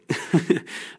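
a quick airy chuckle at himself; short breathy laugh. Real, not acted. Radio host laughing at the star's silly name, short airy chuckle.

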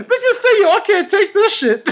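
high, breaking-up protest; high-pitched laughing surrender; overcome with laughter. Real, not acted. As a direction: plead helplessly through real laughter.

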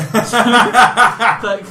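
words breaking into laughter; laughing through it. Real, not acted. Bursts out laughing.

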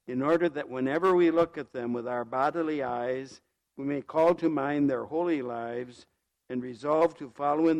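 formal blessing prayer, intoned; cathedral echo. Real, not acted. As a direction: pray it out in a church. Priest blesses the statue.

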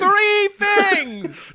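high, drawn-out laughing protest; exasperated outburst. Real, not acted. Player topping own boast as friends tease, high protesting cry. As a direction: belt it out in mock indignation.

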